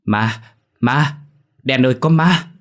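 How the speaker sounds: rising, scared cry of a ghost sighting; terrified stuttering call to a friend; frightened stammer. A narrator's reading. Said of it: "Nó run rẩy lắp bắp".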